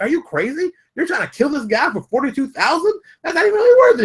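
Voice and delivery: high-pitched mock disbelief; incredulous. People questioning a man's forty-two thousand grudge, mock incredulous.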